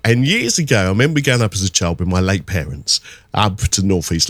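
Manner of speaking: ordinary talk, nothing marked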